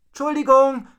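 high, loud called apology; high-pitched holler; calling out. A narrator's reading. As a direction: shout sorry up to someone far above. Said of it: "rief Rocco".

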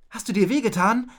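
high, loud concern; worried question. A narrator's reading. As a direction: ask anxiously if they are hurt. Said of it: "fragte Rocco besorgt".

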